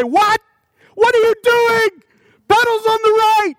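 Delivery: high, loud retold yell at a driver; road rage yelling. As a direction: bark it at the car ahead like you've lost your patience. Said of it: "I start yelling at the other cars".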